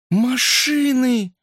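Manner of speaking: high, drawn-out cry; excited exclamation. A narrator's reading. Sally spotting cars, exclaiming excitedly. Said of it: "воскликнула Салли".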